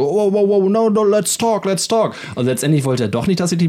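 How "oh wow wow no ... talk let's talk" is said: higher, backpedalling plea; mock panicked pleading. Thug suddenly pleading to talk, mock panic. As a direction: back off fast and plead.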